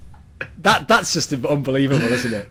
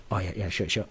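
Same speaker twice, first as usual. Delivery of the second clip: low, soft acted aside; muttered aside; 7 semitones lower, 8 dB quieter than usual. Teammates putting up with the analysis, muted aside. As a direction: keep it down, half under your breath.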